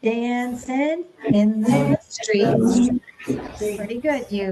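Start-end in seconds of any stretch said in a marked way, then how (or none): 0.0-2.9 very slow, evenly paced; slow rhythmic say. Shown as a demonstration. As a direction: say it slowly to the beat. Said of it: "Will you say that with me".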